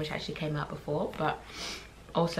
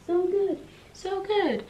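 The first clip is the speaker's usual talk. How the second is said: high loud gush; excited; 14 semitones higher, 4 dB louder, slower than usual. Real, not acted. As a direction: squeal it out. Gushing about the book.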